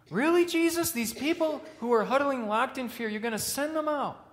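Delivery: high, loud, disbelieving challenge; really, you'd trust THEM?; incredulous. Pretend. Preacher questioning Jesus' choice, high incredulous disbelief.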